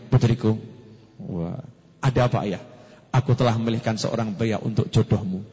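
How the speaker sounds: a hint of a stately father; firm father. Father announces daughter's match, low and steady. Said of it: "bapaknya dengan tegap".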